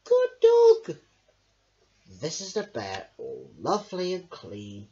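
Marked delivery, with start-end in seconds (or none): 0.1-1.0 very high, loud cartoon voice; squeaky praise. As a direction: praise the dog brightly. Praising the dog.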